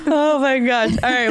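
laughing, near tears. Real, not acted. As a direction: say it while laughing hard. Laughing reaction.

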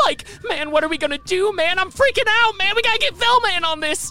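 high, loud panicky stoner voice; Shaggy voice. Acted. Shaggy panicking over a dead body, high and loud. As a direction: squeal it out like a scared hippie. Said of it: "I have to be Shaggy".

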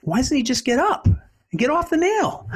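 disbelieving, rising to a high question; lightly voiced young man, baffled; young neighbor voice. Young man asks why the dog won't get off the nail, baffled.

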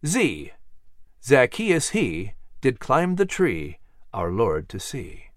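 slow, measured alphabet recital; rhyming recitation. A narrator's reading. Narrator reciting the New England Primer to the students, slow and measured. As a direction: recite each couplet like a schoolroom lesson.

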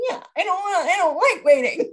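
high, put-on whiny kid voice; mother mimics teenage son admitting he hates waiting; imitating her teen son. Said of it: "secretly just like".

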